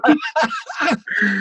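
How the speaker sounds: a high laugh that rings out; amused high laugh; high laughing burst. Real, not acted. Host laughs at staff warning.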